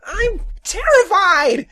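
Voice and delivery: very high, loud, frightened little man; panicked high-pitched protest; terrified cry. Acted. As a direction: wail it out in fright. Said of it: "he cried".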